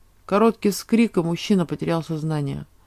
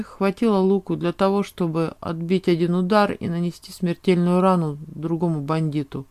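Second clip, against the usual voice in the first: usual speaking voice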